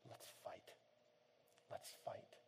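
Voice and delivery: soft, whispered determination; quietly rally them; hushed resolve. Real, not acted. Preacher urging the congregation, whispered resolve.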